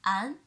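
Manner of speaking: "an" in second tone. Shown as a demonstration. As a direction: lift "an" upward. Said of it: "The second tone".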